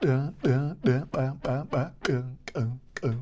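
sharp popped ejective uh sounds; ejective stop sounds. Shown as a demonstration. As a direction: pop each stop out with a burst of air. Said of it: "more often done with D, B, and G sounds".

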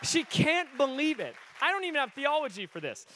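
high, loud cry of amazement from the pulpit; rousing preacher's exclamation, loud and high; full-force preaching. Real, not acted.

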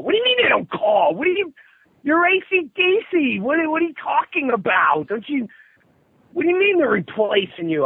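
very high, squealing incredulity; frantic disbelief. Retold or imitated. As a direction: re-enact a panicked rant way up high. Acts out his frantic questioning.

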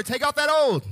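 loud, high and emphatic; say it like you mean it. Shown as a demonstration. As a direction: command it with full conviction. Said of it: "Now say it like you mean it".

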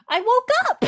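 put-on high, giggly toddler voice; high laughing kid; little kid voice. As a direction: chirp it like a proud little boy, laughing through it. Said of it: "you could literally hear him go".

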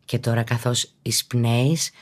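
ordinary talk, nothing marked

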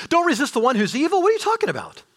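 high, fast disbelieving question; mock disbelief at Jesus' teaching; incredulous. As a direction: sound stunned by the command.

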